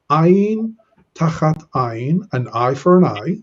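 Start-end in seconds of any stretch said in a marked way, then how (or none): 0.1-2.3 "Ayin tachat ayin" read slowly and carefully, higher; slow Hebrew reading. Shown as a demonstration. Teacher reads Exodus to the class, slow and careful. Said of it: "notice the verse here".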